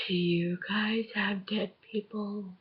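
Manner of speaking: slow, hushed prank-call question; quiet and deliberate, retelling a prank; prank caller. Retold or imitated. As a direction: ask it softly, drawing it out.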